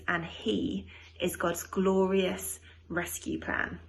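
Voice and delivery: put-on slow, storytelling voice for kids; reading to children. A narrator's reading. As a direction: tell it slowly like a bedtime story. Reads the Christmas story to children.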